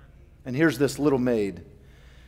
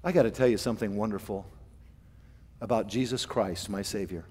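sheepish quiet confession; 6 semitones lower, 10 dB quieter, faster than usual. Retold or imitated. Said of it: "and then say".

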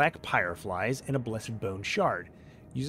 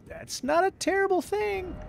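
silly high voice. Pretend.

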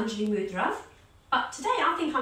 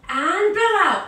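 strong exhale cue; 5 semitones higher, 8 dB louder, slower than usual. Shown as a demonstration. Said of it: "strong breath".

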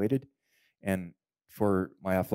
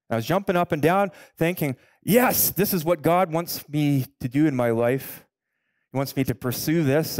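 excited; 8 semitones higher, 7 dB louder than usual. Retold or imitated. Joy after healing.

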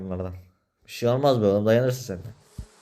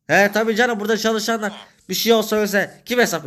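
sarcastic; 8 semitones higher, 3 dB louder than usual. Acted. Arguing about workers' insurance, raised and sarcastic.

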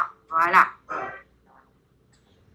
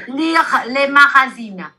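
slow model phrase; much slower than usual. Shown as a demonstration. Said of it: "teacher models phrase for repeating".

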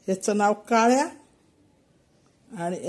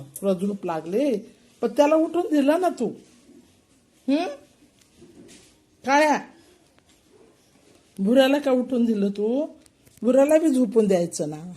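scolding pets; 4 semitones higher than usual. Real, not acted. Owner scolding the cat for hitting his brother, higher mock scolding.

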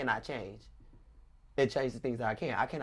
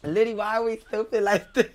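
laughing; 7 semitones higher, 9 dB louder than usual. Real, not acted. Still laughing at herself.

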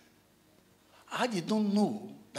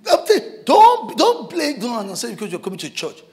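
much higher, loud warning; urgent shout; 4 semitones higher, 13 dB louder than usual. Real, not acted. Preacher warning congregation about war, shouting. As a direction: drive the warning home hard.

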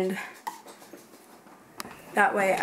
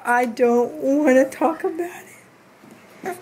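slow, higher pretend baby voice; voicing the baby; 4 semitones higher, 3 dB louder, much slower than usual. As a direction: speak for a sulking baby. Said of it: "I think he's like".

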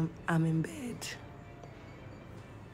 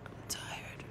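tired whisper; 16 dB quieter than usual. Real, not acted. Tired host whispering to viewers late at night.

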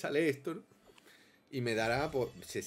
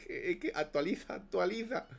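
laughing; 7 semitones higher than usual. Real, not acted. Laughs at the skin.

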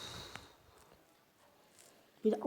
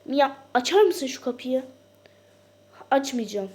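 mock quarrel; 4 semitones higher, 9 dB louder than usual. Door quarrel.

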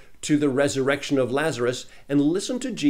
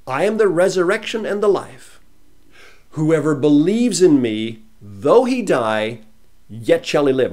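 scripture reading; 3 semitones higher, 7 dB louder than usual. Acted. Same reading repeated.

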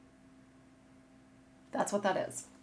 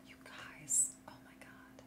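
awed whisper; 5 semitones lower, 20 dB quieter than usual. Real, not acted. Awed by yarn skein.

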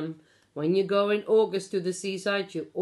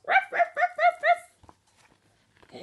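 dog barks; 4 dB louder, much slower than usual. Pretend. Dogs barking at seagulls.

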